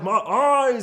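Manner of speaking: loud, high hammed-up cry of pain; mock blinded yell. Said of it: "he's all like".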